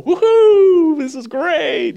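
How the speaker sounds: hammed-up high whoop of glee; mock cheering at a funeral. Asking mourners if they'd cheer at a funeral, mock glee. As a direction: whoop like it's a party. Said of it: "Are you supposed to go".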